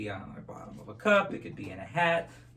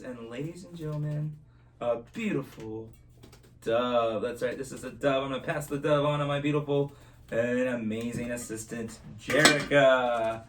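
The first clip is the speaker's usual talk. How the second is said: put-on kids party magician; 3 dB louder than usual. Magician presents the dove.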